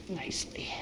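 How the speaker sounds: whispering so the sizzle can be heard. Real, not acted. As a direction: hush down to a whisper over the pan. Listening to cheese sizzle.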